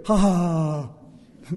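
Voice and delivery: voiced ha ha ha fading into a breathy last ha; imitated laugh. Teacher imitating the Dalai Lama laughing at himself. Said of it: "he laughed at himself".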